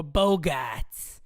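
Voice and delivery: slow drawn-out Boston cheer; mock Boston fan. Imagining his fiancée cheering a Red Sox homer, mock Boston accent. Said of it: "Imagine a cheering for a Red Sox homer".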